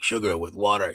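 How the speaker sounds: low, deep imitation; deep movie-character voice. Showing how a schoolmate talked via a movie quote, deep voice. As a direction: drop into the alien farmer's deep voice. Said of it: "Oh, yeah, the deep old".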